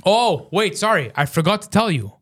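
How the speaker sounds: normal talking voice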